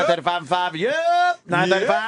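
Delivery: rapid-fire bid calling; auctioneer. Retold or imitated. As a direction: rattle off bids like an auctioneer. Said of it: "how an auctioneer can talk so fucking fast".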